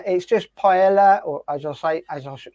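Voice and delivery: ordinary delivery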